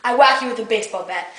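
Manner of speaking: normal talking voice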